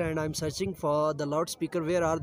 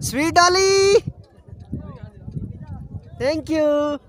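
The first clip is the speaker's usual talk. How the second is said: calling out; 12 semitones higher, 9 dB louder than usual. Real, not acted. Calling out to someone below.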